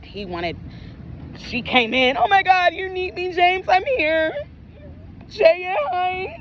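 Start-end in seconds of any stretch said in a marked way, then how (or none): 2.2-6.4 high squealy put-on voice, hammed-up; mock gushing greeting. Woman greeting the daughter, mock gushing greeting. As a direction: mimic her fawning over the child in a high voice.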